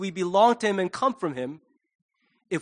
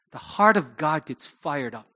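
ordinary talk, nothing marked

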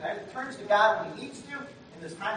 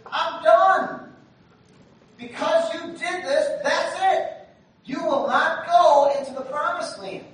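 stern God; 8 semitones higher, 7 dB louder, slower than usual. Retold or imitated. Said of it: "you tell them".

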